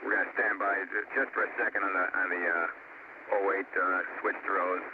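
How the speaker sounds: crackly shuttle radio transmission; breaking up space radio. Real, not acted. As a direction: voice through distant air-to-ground radio. Shuttle crew calling over radio.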